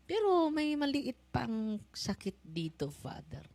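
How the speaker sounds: a touch of a pained parishioner pleading; quoted complaint. Retold or imitated. Possessed woman quoted to priest. (bahagyang ginayang daing ng babae)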